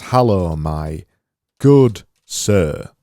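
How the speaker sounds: loud, hammed-up English accent, broken by pauses; ridiculous English accent. Designer voicing his font sample, ridiculous English accent. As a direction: play up the English accent absurdly. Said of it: "make it a bit more ridiculous".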